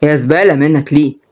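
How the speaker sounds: ordinary delivery, nothing marked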